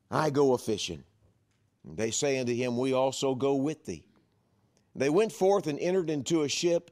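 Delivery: ringing pulpit reading; emphatic scripture reading. A narrator's reading. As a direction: proclaim the Bible verse to the congregation. Reads scripture aloud to congregation.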